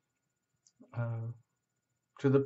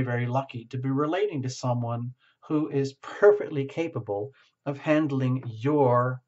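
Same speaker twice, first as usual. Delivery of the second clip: usual speaking voice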